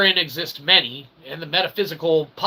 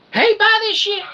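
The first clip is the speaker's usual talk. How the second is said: much higher mock sales pitch; mock street hawker; 4 dB louder than usual. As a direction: call out your wares like a pushy market vendor, joking.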